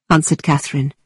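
ordinary delivery

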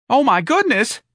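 very high, loud squeak. A narrator's reading. Mister Gonzalez reads the letter, squeaking. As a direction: squeal it in alarm at the top of your pitch. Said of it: "Mister Gonzalez squeaked".

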